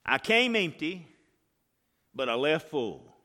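loud rousing preacher delivery; preaching loud. Acted.

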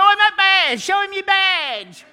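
high, loud shout across a field; loud high hollering from the fence; yelling. Retold or imitated.

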